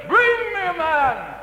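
slow, loud, booming giant's challenge; Goliath's challenge. Acted. Goliath calls out to Israel's army in a slow, booming roar.

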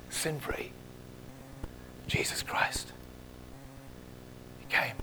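soft hushed reverence; whisper. Real, not acted. Preacher whispering Christ's name in awe. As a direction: breathe the name quietly. Solemn naming of Jesus.

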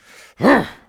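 a loud, high snort like a warhorse about to charge; indignant horse snort. Shown as a demonstration. Preacher showing the indignant snort of a warhorse, loud snort. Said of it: "that snorting sort of noise".

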